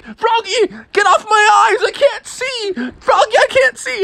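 very high, frantic squawk; panicking dad. Acted. Dad blinded by Froggy on head, frantic. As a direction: yelp frantically at your son. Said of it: "his dad was like".

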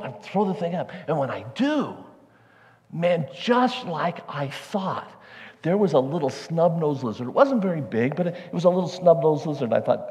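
ordinary talk, nothing marked

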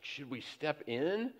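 quiet, murmured question to her husband; hushed aside. Retold or imitated. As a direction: ask it under your breath at the table. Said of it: "Abby's like".

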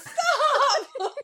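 high laughing cry of stop. Retold or imitated. Mom telling her son to stop saying it, high and laughing. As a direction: plead through laughter. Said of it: "and I'm like".

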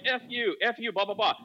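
high-pitched mock brush-off; mock dismissive woman. Woman rejecting a clinic preacher, imitated high-pitched dismissal. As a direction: toss it off as an imitated angry rebuff.

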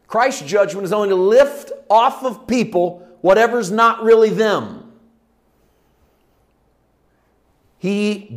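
loud, high, emphatic sermon delivery; full-force preaching. Real, not acted. Preacher declaring Christ's judgment to the congregation, full force. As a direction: drive each phrase home from the pulpit.

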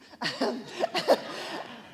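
breathy laugh at her own joke; burst of laughter. Real, not acted. Amused at calling the Holy Spirit he, she bursts out laughing. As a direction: laugh out at the slip. Joking about God's pronoun.

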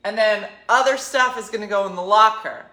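loud, fast, pushy explaining; pushy guide. Retold or imitated. Guide lecturing nervous tourists, loud and pushy. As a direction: bulldoze the customer with rules. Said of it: "he's like".

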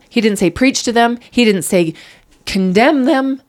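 sermon-like outburst to the host; impassioned plea. Real, not acted. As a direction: drive each "He didn't say" home. Impassioned faith speech.

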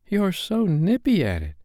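put-on high-pitched boyish voice; young boy's admiring remark. A narrator's reading. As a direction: say it up high, like an impressed boy. John admires Peter's flying.

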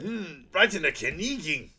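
lightly put-on comic character voice; Goldmember. Goldmember reacting to the blow, lightly hammed-up. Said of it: "Goldmember's like".

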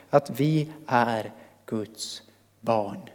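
slow, soft and low, word by word; the Spirit's gentle voice. Acted. Preacher voicing the Spirit's assurance to believers, tender and slow. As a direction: let each word settle softly and slowly. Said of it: "Vi ska känna igen hans röst".

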